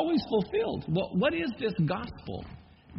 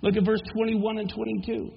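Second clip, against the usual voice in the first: normal talking voice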